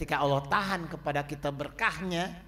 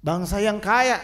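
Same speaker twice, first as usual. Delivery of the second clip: emphatic preaching cry; 5 semitones higher, 9 dB louder than usual. Shown as a demonstration. Preacher repeats the key phrase.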